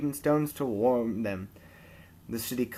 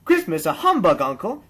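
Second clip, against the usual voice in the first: cheerful nephew; 4 semitones higher, 7 dB louder than usual. A narrator's reading. Nephew voiced teasingly.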